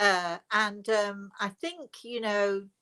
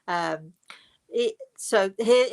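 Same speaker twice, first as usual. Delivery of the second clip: ordinary delivery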